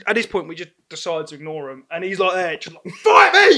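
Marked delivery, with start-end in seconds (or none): 3.0-3.6 very high, loud mock yell; full-voiced imitation of a drunk challenge; drunk challenger. Ignored drunk yelling at the group, hammed-up shout.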